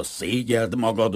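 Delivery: ordinary delivery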